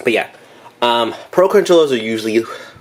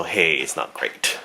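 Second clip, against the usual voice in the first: slow, softer, let-down "not great"; deflated shrug; 3 semitones lower, 5 dB quieter, slower than usual. Pretend. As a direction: drop it low and quiet. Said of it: "and it's also".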